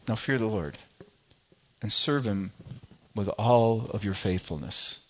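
put-on an old leader's last quiet charge; solemn Joshua. Joshua pleading with his people before he dies, low and hushed. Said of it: "Just imagine Joshua sitting before you and say".

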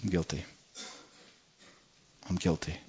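defeated, tiny voice; shrunken confession. Retold or imitated. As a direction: shrink down and barely get it out. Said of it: "kinda shrink down and go".

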